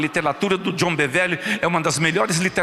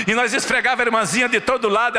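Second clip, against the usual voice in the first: ordinary talk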